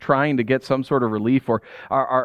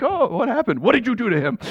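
worried parent; 6 semitones higher than usual. Retold or imitated. Parent rushing to crying kid.

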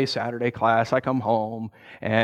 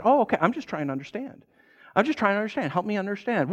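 gentle soothing; 6 semitones higher, 4 dB quieter than usual. Retold or imitated. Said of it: "pastor soothing".

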